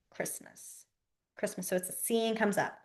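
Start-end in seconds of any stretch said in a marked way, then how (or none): 0.1-1.7 near-voiceless whisper; whispered demo. Shown as a demonstration. Teacher demonstrating the Christmas sign, whispered. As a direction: mouth the word barely aloud. Signing demo, word softly mouthed.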